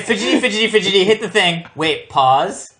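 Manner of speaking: teasing impression of a fussy director; mock director. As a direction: tease him by playing the fussy director giving cues. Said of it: "you're like".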